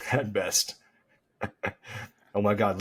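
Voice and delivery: normal talking voice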